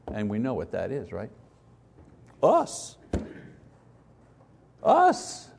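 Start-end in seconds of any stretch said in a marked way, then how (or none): 2.4-3.0 high exclaimed us; spring the answer on them; rhetorical us. Acted. Preacher answering his own question, exclaimed high. Answering who stops God. 4.8-5.5 much higher, incredulous us; emphatic repeat. Acted. Preacher repeating the answer, incredulous and high.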